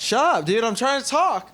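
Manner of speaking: whiny outburst, pitch jumping up; mock exasperated. Podcaster cut off by his friend mid-question, mock exasperated. Interrupted while trying to talk.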